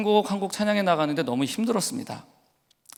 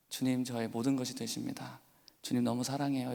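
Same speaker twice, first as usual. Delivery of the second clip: softer, hushed confession of love to the Lord; tender prayer; 3 semitones lower, 6 dB quieter than usual. Retold or imitated. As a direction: pray it quietly, close and sincere. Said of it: "이렇게 고백을 했습니다".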